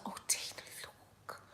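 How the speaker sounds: very soft, hushed whisper to a sister; retold awed whisper. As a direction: whisper it as if showing something precious. Retelling her awe at the gift.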